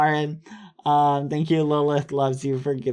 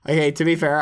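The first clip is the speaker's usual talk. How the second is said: ordinary delivery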